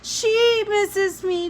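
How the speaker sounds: put-on high, slow child's plea; slow high childish whine; pleading little boy. A narrator's reading. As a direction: beg like a tearful small boy. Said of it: "Jamie said".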